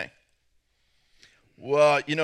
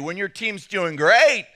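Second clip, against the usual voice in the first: excited rise; 3 semitones higher, 5 dB louder, slower than usual. Acted. Pastor on men watching their team win, excited. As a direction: lift it and punch the last word. Contrasting winning team with losing.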